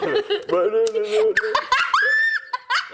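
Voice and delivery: high, long burst of laughter; hearty laughter. Real, not acted. Cracking up at the imitation.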